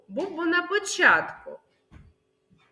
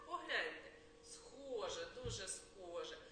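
ordinary talk

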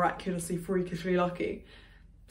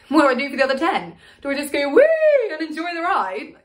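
raised, loud questioning that breaks into a whoop; agitated mock glee; 7 semitones higher, 7 dB louder than usual. Wondering how to fill the last seconds of a fall, agitated mock glee. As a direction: build from urgent questioning to a high mock-joyful "woo".

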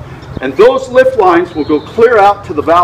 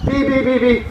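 short high burst of beeps; beeps past the peak; 8 semitones higher, 3 dB quieter than usual. Shown as a demonstration. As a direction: mimic the alarm starting to drop off. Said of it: "as it starts to drop off".

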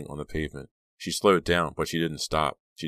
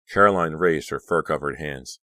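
ordinary delivery

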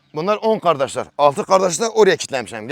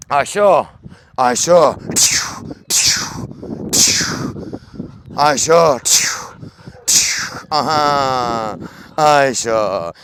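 calling out; 4 semitones lower, much slower than usual. Real, not acted. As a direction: call her name out to the yard. Calling out to Ayşe.

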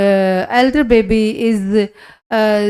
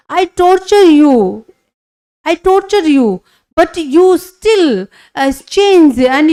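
retold outburst, pitched higher and louder; mock-emotional husband, raised and louder; husband's outburst; 5 semitones higher, 4 dB louder than usual. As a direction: voice the husband's pleading amazement.

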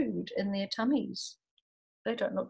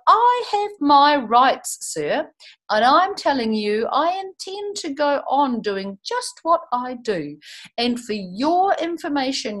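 louder, slow storybook telling-off; the Onceler shouting down the Lorax; read-aloud angry rant at the Lorax; mock yell; 3 semitones higher, 10 dB louder, slower than usual. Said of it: "I yelled at the Lorix".

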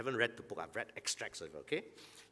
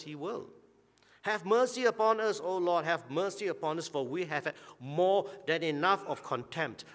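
scripture reading; 2 semitones higher, 5 dB louder than usual. Acted. Preacher reading the psalm to his congregation, fervent and rising. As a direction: read the psalm like a plea to God.

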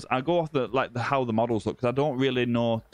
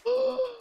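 gasp. Real, not acted. Reacting to huge catch.

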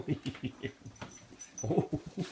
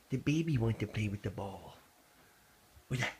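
ordinary delivery

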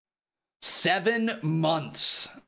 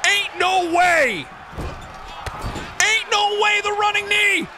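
loud, excited wrestling commentary; live play-by-play; 6 dB louder than usual. Acted. Streamer calls his wrestling match live, excited and loud. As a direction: call every move like a ringside announcer.

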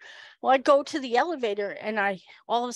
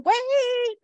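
drawn-out high mock cry; imitated holler, shooting way up in pitch; high-pitched call; 13 semitones higher, 3 dB louder than usual. Woman stops the departing cop, high mock call. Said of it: "Irene's going".

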